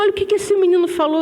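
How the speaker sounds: fast, high amazed telling; lightly voiced grandma, amazed at the boy; excited grandma. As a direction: hurry it out, thrilled. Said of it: "Aí minha mãe, Karina".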